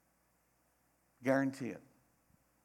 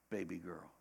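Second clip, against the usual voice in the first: tender reveal; 6 semitones lower, 11 dB quieter than usual. Real, not acted.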